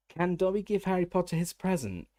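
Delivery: high, hesitant squeak; squeaky house elf. A narrator's reading. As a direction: squeak it out shyly. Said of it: "he squeaked tentatively".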